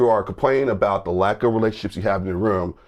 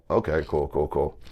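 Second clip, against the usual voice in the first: low, much softer deadpan; mock calm as security escorts him out; sarcastic okay cool; 7 semitones lower, 6 dB quieter than usual. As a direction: shrug it off under your breath. Sarcastic reply after escort.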